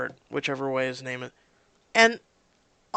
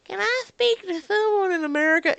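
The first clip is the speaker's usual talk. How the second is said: much higher whiny caller demanding America; mock the entitled customer; rude caller; 5 dB louder than usual. Impatient caller on the phone, high and whiny. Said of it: "people who are like".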